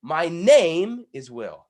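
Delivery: slow and loud, stress on "name" in "My name is Will."; wrong emphasis. Shown as a demonstration. Coach trying the stress on "name", still wrong. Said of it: "No, that's not quite right either".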